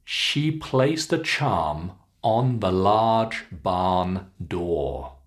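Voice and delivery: slow, long vowels, dropping on "door"; falling intonation. Shown as a demonstration. Teacher models sentence two, slow, falling at "door". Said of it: "bring it down at the end".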